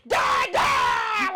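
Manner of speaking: high, loud demanding yell; aggressive toddler shout. Retold or imitated. Toddler calling his dad mid rough play, loud aggressive yell. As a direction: bellow it like a rough-playing toddler. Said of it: "he's so aggressive".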